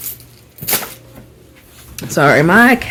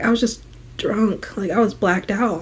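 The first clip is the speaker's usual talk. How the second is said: soft, sheepish excuse; 9 dB quieter than usual. Retold or imitated. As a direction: mumble the excuse.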